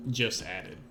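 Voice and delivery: ordinary talk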